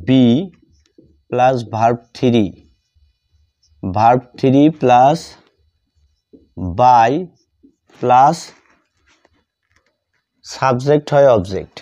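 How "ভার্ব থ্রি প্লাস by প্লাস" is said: ordinary talk, nothing marked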